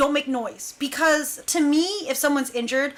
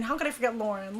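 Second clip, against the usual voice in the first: normal talking voice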